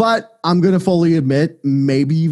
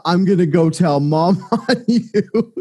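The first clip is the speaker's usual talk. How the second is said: put-on slow, laughing kid's tattle; a laughing, hammed-up kid tattling; tattling little kid; 3 semitones higher, slower than usual. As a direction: play the whiny kid threatening to tell mom. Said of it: "and then I did the".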